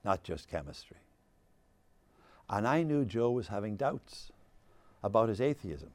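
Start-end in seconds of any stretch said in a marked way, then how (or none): 0.0-1.0 soft, choked-up admission; tearful friend speaking quietly. Retold or imitated. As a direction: say it through tears, softer. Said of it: "And he said".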